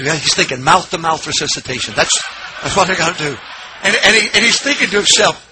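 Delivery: usual speaking voice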